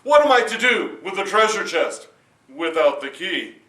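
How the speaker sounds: loud king's complaint; demanding king. Acted.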